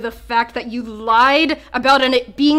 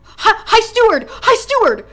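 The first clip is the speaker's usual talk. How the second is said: calling out; 9 semitones higher than usual. Acted. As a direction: shout it to get her attention. NPC calling out across room.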